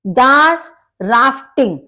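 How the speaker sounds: Das Rafting very slowly, in parts; slow split-up word. Shown as a demonstration. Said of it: "अलग-अलग divide करके बोलना चाहिए". (Das Rafting को धीरे-धीरे)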